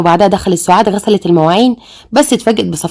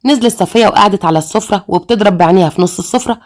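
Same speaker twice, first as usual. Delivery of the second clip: ordinary delivery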